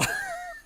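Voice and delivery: quick high squeaky laugh; a real squeal of laughter; a short high laugh at a viewer's comment; burst of laughter. Real, not acted. Short laugh after reading comment.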